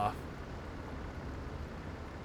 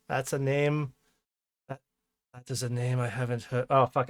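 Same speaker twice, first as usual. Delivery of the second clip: normal talking voice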